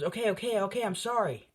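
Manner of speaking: high, hurried mock begging; panicked apology. Bakugo pleading with Izuku while falling, high panicked begging.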